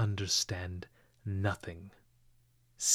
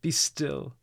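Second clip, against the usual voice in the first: anguished cry; 5 semitones higher than usual. A narrator's reading. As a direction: cry out to stop him. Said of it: "cried Sonia".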